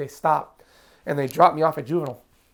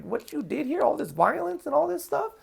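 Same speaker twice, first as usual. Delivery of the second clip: blunt public defender; 6 semitones higher than usual. Retold or imitated. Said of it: "He's like".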